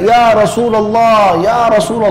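high, drawn-out call from a distance; deliberately loud call. Retold or imitated. Abu Bakar calls the Prophet from afar, loud and slow. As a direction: call out across the crowd on purpose. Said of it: "suara keras sengaja".

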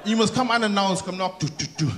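normal talking voice

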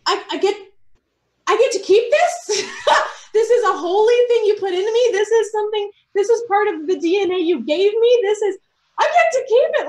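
high, incredulous joy with a laugh; amazed delight. Retold or imitated. Believer answering the Lord about keeping this, amazed delight. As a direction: marvel at an unexpected gift, pitch soaring. Acts amazed reply to God.

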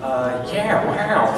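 light impression with a lifted "wow"; a hint of a put-on film-star voice; Owen Wilson. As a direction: drop into a mild celebrity mimicry. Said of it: "Is Owen Wilson a, an accent?".

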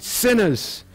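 high, scornful mock cry of sinners; bitter exasperation. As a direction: spit it out fed up after a bad day. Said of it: "you're just like".

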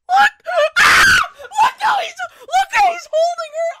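high, loud squealing fan; excited squeal. Real, not acted. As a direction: shriek it out with delight. Fan squealing at anime scene.

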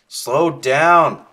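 loud calling after someone. Real, not acted. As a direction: call out after her. Calling to orb.

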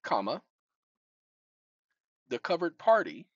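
dictating. Real, not acted. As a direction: speak each phrase for voice typing. Dictating to a phone.

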